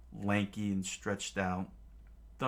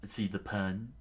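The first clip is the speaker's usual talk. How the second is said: ordinary delivery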